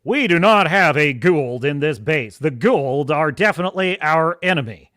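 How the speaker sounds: lightly voiced Hammond with Goa'uld as "gold"; General Hammond impression. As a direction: play a stern base commander. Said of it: "he says it in the most America way".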